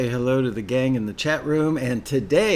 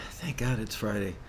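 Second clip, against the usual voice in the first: whispered; 3 semitones lower, 8 dB quieter than usual. Acted. Catchphrase repeated quietly.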